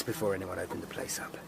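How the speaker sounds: hushed whisper. Acted. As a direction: breathe it out under your breath. Grim thought at a murder scene.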